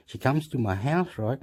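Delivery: ordinary talk, nothing marked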